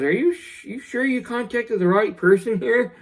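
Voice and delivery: high, disbelieving question to the hospital caller; incredulous disbelief. Retold or imitated. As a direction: pitch jumps high in shocked doubt. Dad doubting the hospital's call.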